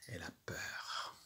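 murmure complice pour l'enfant; souffle-le comme un secret effrayant; chuchoté. Acted. (chuchotement inquiet)

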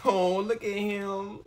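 high, slow, drawn-out sympathy; pitying coo. Real, not acted. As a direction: coo with pity, stretching the words out. Pities the scared soldier on screen.

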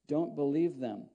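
ordinary talk

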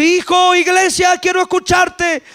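high, carrying divine summons; calling out. Acted. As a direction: call to the church as the Lord. Acts the Lord calling the church.

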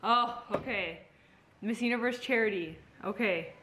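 normal talking voice

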